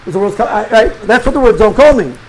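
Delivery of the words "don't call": alarmed man's reading. Retold or imitated. Man reads it as alarm.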